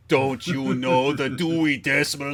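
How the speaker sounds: slow, hammed-up movie-quote yell; mock yell. Dad quoting UHF at his daughter, slow mock yell. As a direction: put on a voice and draw out the scolding. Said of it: "just yell at her".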